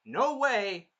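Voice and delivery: emphatic retold refusal; mock refusal. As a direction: shut down the plea, imitating a hero. Acts out Naofumi refusing Melty.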